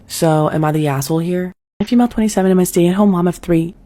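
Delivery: ordinary delivery, nothing marked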